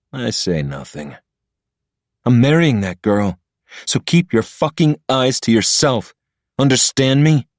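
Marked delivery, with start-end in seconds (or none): 2.2-7.5 higher, lightly voiced warning; threatening rival. Patrick warning Ilya off his fiancée, a hint of a bully. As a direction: drive the threat home.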